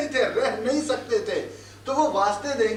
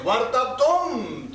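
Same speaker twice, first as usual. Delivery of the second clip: ordinary talk, nothing marked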